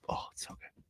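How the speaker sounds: soft, breathy whisper of pleasure; whispered delight. Real, not acted. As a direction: whisper it like a secret treat. Savoring the taste of tea.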